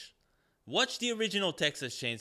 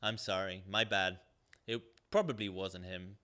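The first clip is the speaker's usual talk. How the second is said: mock sheepish, much lower and softer; sheepish apology; 7 semitones lower, 4 dB quieter than usual. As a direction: mumble a lame apology lightly. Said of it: "and he's like".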